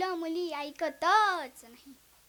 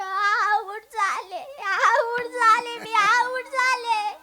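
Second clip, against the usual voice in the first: mock wail; 3 semitones higher, 5 dB louder, much slower than usual. Storyteller wailing after being hit.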